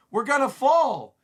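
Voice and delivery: high, loud cry of alarm; acted panic. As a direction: shout it as the ship goes down. Crew panics as ship falls.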